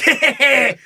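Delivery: high "heh heh heh" chuckle; mock creepy laugh. Imitating the hacker laughing as he drives around, high mock chuckle. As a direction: imitate a creep cackling to himself. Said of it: "like, laughing".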